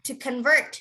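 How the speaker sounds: "convert" said con-VERT, rising; strong end on "convert" for repeating; back-stressed "convert"; stress on second syllable. Shown as a demonstration. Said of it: "if it's a verb".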